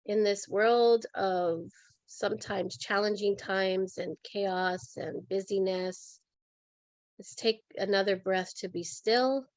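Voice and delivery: softly measured meditative prayer with long pauses; calm, reverent invocation; guided meditation. Real, not acted. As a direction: settle listeners into stillness, unhurried and gentle. Leads a breathing and centering meditation.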